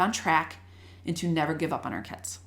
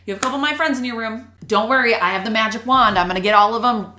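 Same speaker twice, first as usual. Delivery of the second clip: loud, raised-pitch imitation of a confident colleague; EL teacher; 5 semitones higher, 8 dB louder than usual. Language teacher reassuring a new colleague about students, loud and confident. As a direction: announce it loudly like a teacher with the fix. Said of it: "came in and goes".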